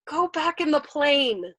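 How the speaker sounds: slow, loud, high plea; pleading. A narrator's reading. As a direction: beg him slowly and helplessly. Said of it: "pleading with him now".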